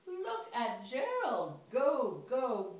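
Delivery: loud, high cheer. Acted. Animals cheering Gerald as he dances, loud and high. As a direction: cheer him on.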